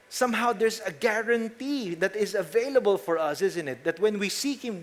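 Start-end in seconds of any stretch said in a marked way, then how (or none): none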